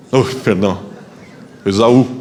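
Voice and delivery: low put-on voice posing as Esaú; hasty low-voiced impersonation of the brother; disguised voice. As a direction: drop your voice to pass as your brother. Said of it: "mudou a entonação de voz".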